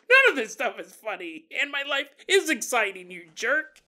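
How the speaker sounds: mad. Acted. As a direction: act the offended man snapping back. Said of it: "He's all mad".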